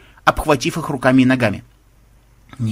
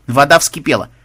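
ordinary delivery

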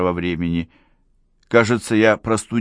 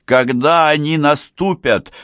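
raised, slow despairing question; pleading; 7 semitones higher, 5 dB louder than usual. A narrator's reading. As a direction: ask it in anguish, slowly. Young man pleads.

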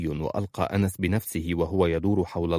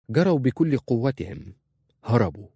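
ordinary delivery

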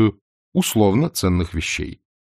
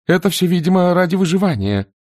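timid little man; 9 semitones higher than usual. A narrator's reading. Timid Vonce voice, higher.

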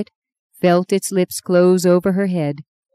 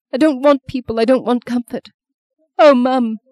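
high, distressed refusal; breaking into grief; 6 semitones higher, 3 dB louder than usual. Acted. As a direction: push comfort away, near sobbing. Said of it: "She began to sob bitterly".

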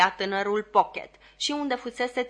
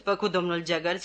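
ordinary delivery, nothing marked

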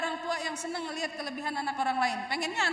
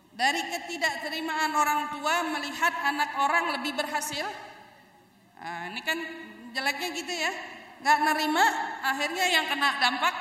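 ordinary delivery

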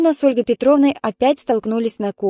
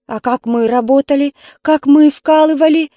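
rising, louder indignation; getting worked up; 5 semitones higher, 7 dB louder than usual. Acted. Mother gets worked up lecturing her daughter, heated. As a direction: build up the heat on «вкалывали». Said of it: "завелась Ольга Петровна".

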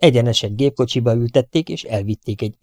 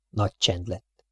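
ordinary delivery

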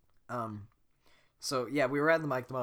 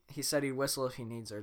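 usual speaking voice

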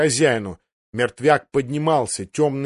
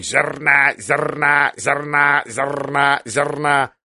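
repeated croaking bird cries, each held on one note; raven's cry; much slower than usual. A narrator's reading. Raven flying over Mormont's head in the fight, crying out. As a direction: squawk it like a raven, rolling the r. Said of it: "летал с криком над его головой".

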